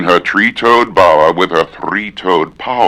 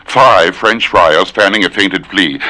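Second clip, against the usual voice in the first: ordinary talk, nothing marked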